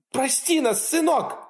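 high, desperate cry; mother cries out. Acted. Terrified mother cries out to her armed son. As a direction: beg for your life. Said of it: "закричала мама".

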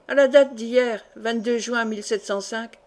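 ordinary delivery

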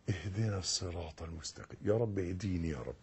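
very soft, murmured supplication; quiet plea. Shown as a demonstration. Worshipper pleading quietly to God. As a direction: breathe it as a private prayer. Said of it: "اطلب".

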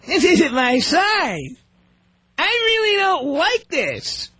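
slow, whiny complaint; mock ungrateful spouse. Spouse complaining to the gift giver, slow and whiny. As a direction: play the spouse unhappy with the gift. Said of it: "And they say something like".

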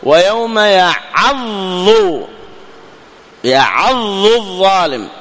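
slow يعض الظالم with the ض swallowed into the ظ; slow demo of the mistake; merged reading. Shown as a demonstration. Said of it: "يعني تدغم".

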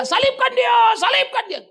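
high, loud mob cry; furious mob demanding crucifixion; crowd yelling. Retold or imitated. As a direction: scream it like an angry crowd. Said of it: "Ayo teriak".

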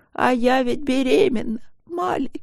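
high, forlorn whine; plaintive confession. A narrator's reading. Wife miserably confesses pregnancy to husband, plaintive. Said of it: "тоскливо призналась".

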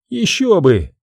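casual, as if in passing; offhand remark. Acted. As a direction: toss it off casually. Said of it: "как бы, между прочим, заметила она". (как бы между прочим)

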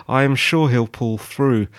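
normal talking voice

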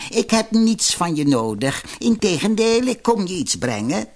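high, falsely sweet crone; wheedling grandmother voice; disguised old woman. A narrator's reading. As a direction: coax the girl with put-on kindness. Disguised villainess coaxes princess.

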